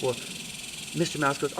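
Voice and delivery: ordinary delivery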